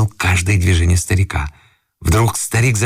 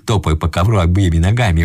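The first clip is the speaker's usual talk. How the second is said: usual speaking voice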